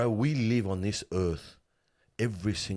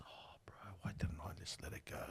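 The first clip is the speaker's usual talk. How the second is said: very soft, voiceless mock lament; whispered regret; 14 dB quieter than usual. Voicing a bereaved brother's regret.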